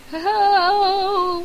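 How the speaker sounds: cheery hello. Pretend. Calling up tech-helper John.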